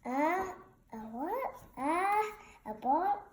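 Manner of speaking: ordinary delivery, nothing marked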